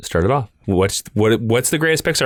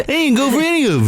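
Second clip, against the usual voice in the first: mock wounded composer; 9 semitones higher than usual. Continues mock composer bit.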